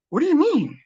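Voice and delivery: high, alarmed what-do-you-mean; startled question. Retold or imitated. To daughter who was given a choice, high alarm. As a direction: ask it suddenly worried, high. Reacting to daughter's strange news.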